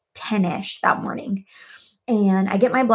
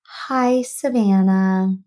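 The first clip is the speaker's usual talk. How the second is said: slow, drawn-out sympathetic hello; imitating a somber clinic call; somber greeting; much slower than usual. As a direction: greet them like bad news is coming. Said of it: "has just been somber".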